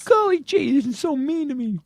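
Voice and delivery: put-on high, gee-whiz kid voice; wholesome 1950s kid. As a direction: play an innocent clean-cut boy.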